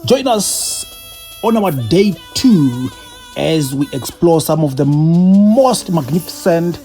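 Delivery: slow, measured travel narration; reflective voiceover. A narrator's reading. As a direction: tell it like a closing voiceover.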